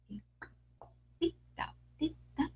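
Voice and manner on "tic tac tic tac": hushed whisper of little steps; whispered tic-tac. A narrator's reading. Narrator whispering the chick's footsteps on the road. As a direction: breathe the tic-tac softly like tiny feet.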